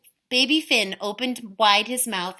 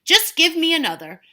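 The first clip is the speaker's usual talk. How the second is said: blustering; 6 semitones higher, 8 dB louder than usual. Acted. Giant boasting.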